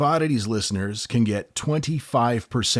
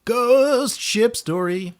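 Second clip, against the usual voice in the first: spooky tease; 13 semitones higher, 4 dB louder, slower than usual. Pretend. Spooky echo of the phrase.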